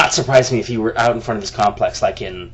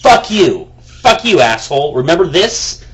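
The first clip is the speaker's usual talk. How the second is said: mock email retort, raised and angry; angry comeback; 2 semitones higher, 5 dB louder than usual. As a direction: spit it back at him. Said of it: "writing him back and going".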